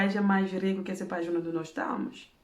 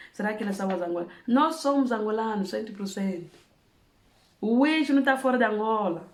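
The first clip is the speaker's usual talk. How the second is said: normal talking voice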